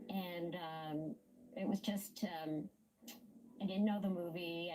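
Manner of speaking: ordinary talk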